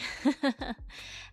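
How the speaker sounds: short giggle; real laugh. Real, not acted. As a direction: laugh in a quick burst. Streamer laughs after greeting.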